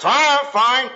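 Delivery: short, clipped fine; husband brushing off wife at door; curt, sullen reply. Retold or imitated. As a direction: brush her off.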